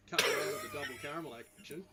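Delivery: laughing through the words. Real, not acted. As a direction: break into laughter mid-tease. Teasing payback over caramel eggs.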